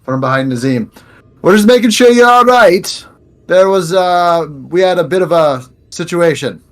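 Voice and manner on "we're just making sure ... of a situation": loud, high call easing off as it goes; raised voice, starting big and losing steam; yelling. Acted. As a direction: holler it from behind the others, then falter. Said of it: "Like, yelling".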